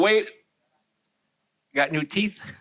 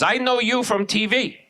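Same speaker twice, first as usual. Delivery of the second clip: mock Hong Kong accent; 3 semitones higher than usual. Hong Kong mall woman recognizing the comic, mock accent. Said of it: "and a woman goes".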